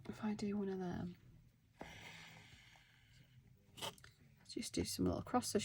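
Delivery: hushed aside to herself while stitching; soft whispered murmur over the work. Real, not acted. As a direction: drop to a breathy whisper, just for you.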